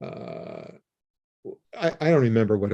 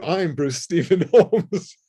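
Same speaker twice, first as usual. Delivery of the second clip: mock-grand, slow, pitched higher, with a laugh; proud declaration; 6 semitones higher, slower than usual. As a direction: announce your own name like a title. Defiantly declaring his own name.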